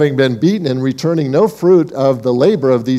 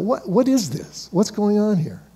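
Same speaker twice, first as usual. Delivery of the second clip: higher, softer puzzled questions; baffled disbelief; 5 semitones higher, 6 dB quieter than usual. Acted. Preacher voicing bafflement at the servants' mistreatment, baffled disbelief. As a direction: ask it hushed and bewildered.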